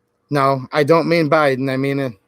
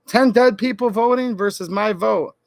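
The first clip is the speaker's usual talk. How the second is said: ordinary delivery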